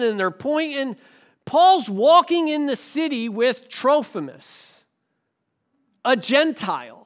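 ordinary talk, nothing marked

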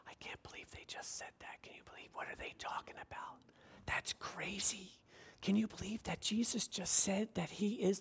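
hushed gossiping whisper; mock whisper. Churchgoer whispering gossip to a friend, hushed. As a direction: whisper behind a hand, scandalized.

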